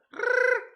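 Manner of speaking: high, loud rolled R; rolled R trill. Shown as a demonstration. Half-Mexican streamer proving he can roll his R's, high trilled R. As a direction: show off a long high tongue trill. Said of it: "I can roll my R's, though".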